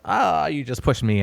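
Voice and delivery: mock-hurt child selling a playful push; overplayed reaction. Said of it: "sells the push".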